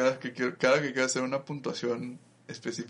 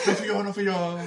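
higher, laughing protest of innocence; mock panicked denial; 9 semitones higher, 3 dB louder than usual. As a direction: deny it in a hammed-up panic. Mock denial after calling police. (negación nerviosa en broma, más aguda)

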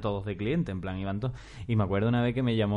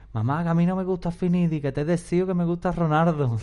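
put-on high, pleading little boy voice; a kid protesting to his mum; child voice; 6 semitones higher than usual. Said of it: "dije yo".

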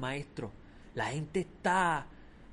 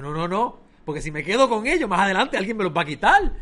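loud, high emphatic refusal; firm refusal; 6 semitones higher, 12 dB louder than usual. Retold or imitated. Preacher retells refusing the cashier's money, loudly. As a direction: refuse it with conviction.

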